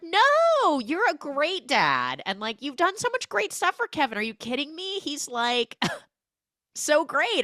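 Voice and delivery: butter him up, playing along; fake gushing. J-Lo flattering the killer to distract him, high gushing.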